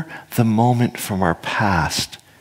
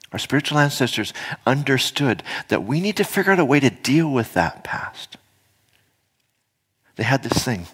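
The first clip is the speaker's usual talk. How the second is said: ordinary delivery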